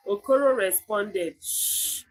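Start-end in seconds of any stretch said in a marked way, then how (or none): 1.4-2.0 soft, voiceless shush; hushing. A narrator's reading. Okoro silencing Ikenna, a soft hush. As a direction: shush him quietly. Hushing his brother's wild talk.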